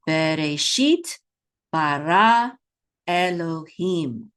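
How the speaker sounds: slow, word by word, each syllable held; slow model reading. Shown as a demonstration. Teacher reads three words with learners, slow and measured. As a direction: read it out in drawn-out syllables with pauses. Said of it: "Let's read these three words".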